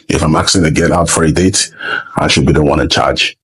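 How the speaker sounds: ordinary delivery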